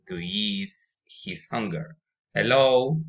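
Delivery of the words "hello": louder, higher hail into the clearing; a friendly call to an empty clearing. A narrator's reading. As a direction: call out loudly, hoping someone answers. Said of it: "he called".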